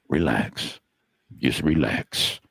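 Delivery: low, slow, soothing reassurance; calming parent. Retold or imitated. Old pro calming the panicked young dealer, low and soothing. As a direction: settle him down like a calm parent. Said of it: "so calm, like just a calming parent".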